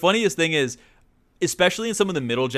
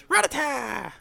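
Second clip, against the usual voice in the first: anime voice; 12 semitones higher, slower than usual. Retold or imitated. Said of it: "in the anime he goes".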